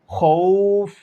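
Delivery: খৌফ with the ৌ drawn out about one alif; stretched vowel. Shown as a demonstration. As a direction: hold the ৌ in খৌফ long before the ফ. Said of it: "এরকম টেনে পড়তে হবে".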